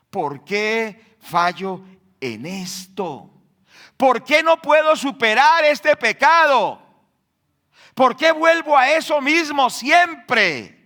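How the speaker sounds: loud anguished prayer of a sinner; anguished cry. Retold or imitated. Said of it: "la persona dice".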